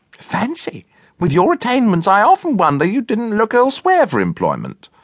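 high-pitched, impressed cook voice; admiring cook. A narrator's reading. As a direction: marvel at him in a high cook's voice.

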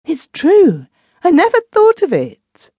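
high, loud, delighted exclamation; cry of joy. A narrator's reading. Wife seizing on husband's idea, a cry of joy. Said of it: "uttered a cry of joy".

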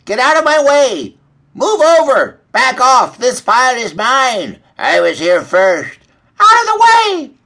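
high, loud squawking hens; shrieky barnyard squabble; loud squabbling. A narrator's reading. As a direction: shout like hens fighting over feed. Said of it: "The scuffling was heard loud and clear".

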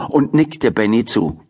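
usual speaking voice

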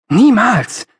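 high, loud outcry of refusal; called out. A narrator's reading. Young idealist rejects concessions, crying out. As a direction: cry it out in protest. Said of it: "rief ich".

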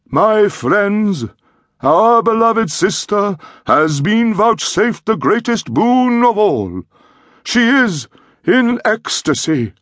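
high, slow, exalted priest intoning the rite; ecstatic high priest. A narrator's reading. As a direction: chant it like a priest offering the sacred cup. Priest addresses congregation.

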